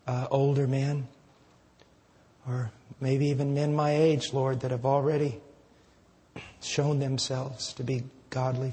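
soft, low, earnest prayer voice; closing prayer. Acted. Pastor closing the service praying quietly to the Lord, soft earnest prayer. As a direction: pray humbly and quietly to the Father.

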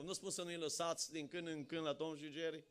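ordinary talk

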